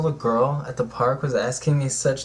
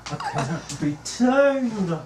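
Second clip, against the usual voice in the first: higher, slow, laughing sass; 11 semitones higher, slower than usual. Pretend. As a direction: laugh through the line. Repeats with laugh.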